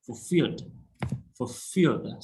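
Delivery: fulfilled with the second syllable feel stressed. Shown as a demonstration. Teacher modelling for students, stress on feel. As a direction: lean on -filled in fulfilled. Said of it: "stress on feel, second syllable".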